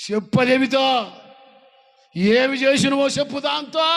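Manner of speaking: high angry shout; wife shouting. Acted. Wife shouts at husband to tell her, high angry yell. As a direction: yell it at him, high and loud.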